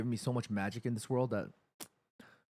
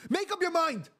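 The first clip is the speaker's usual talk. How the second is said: high, loud, fed-up yell; exasperated shout; 4 dB louder than usual. Real, not acted. Fan yelling at flip-flopping transfer reporters, exasperated shout. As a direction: blast it out in frustration.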